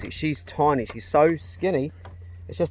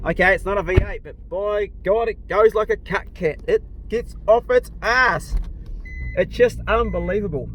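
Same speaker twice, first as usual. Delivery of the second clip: excited; 7 semitones higher, 6 dB louder than usual. Real, not acted. A driver boasting about his car, loud and thrilled. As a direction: revel in the car's power, stretching the words.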